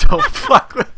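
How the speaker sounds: high, loud and breaking into laughter; can barely get it out for laughing; laughing through words. Real, not acted. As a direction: repeat it as the laugh takes over. Mocking Katie's line while laughing.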